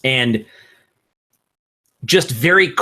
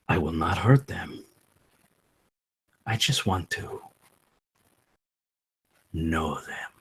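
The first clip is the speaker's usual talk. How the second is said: low, hushed spirit asking to enter the mind; ghostly Piotr quietly coaxing the sleeper to let him in; 5 semitones lower, 4 dB quieter than usual. Acted. GM voices Piotr's spoken request.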